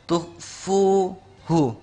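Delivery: tuhfuhu slowly, with a long 'fuu'; slow demonstrated reading. Shown as a demonstration. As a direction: spell out tuhfuhu in drawn syllables. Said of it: "maka dibaca panjang". (tuhfuhu pelan, 'fu' panjang)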